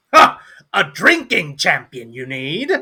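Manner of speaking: loud opening cry, rising question; hammed-up offer from down the table; challenger speaking up. Dwarf answering the call for a champion, theatrical and loud. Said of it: "you just hear, like".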